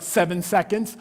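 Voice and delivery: ordinary delivery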